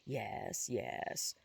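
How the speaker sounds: low, soft, halting repetition; stammering. A narrator's reading. Frightened boy caught by Constance, low faltering stammer. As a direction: falter quietly on each word. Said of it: "he stammered".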